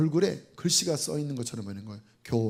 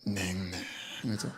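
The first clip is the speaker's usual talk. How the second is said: very low, slow and soft, gone cold; 11 semitones lower, 12 dB quieter, much slower than usual. Shown as a demonstration. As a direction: drop the voice to an icy murmur. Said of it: "얼굴이".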